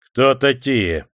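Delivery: stern. A narrator's reading. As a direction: question them as a strict chieftain. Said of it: "строго спросил их атаман". (строго)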